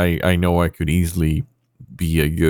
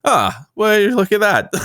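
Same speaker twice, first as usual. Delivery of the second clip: very high, squeaky amused voice; high character voice; 3 dB louder than usual. Acted. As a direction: pitch way up as a cartoon character. Voicing Grumbles noticing face scratch.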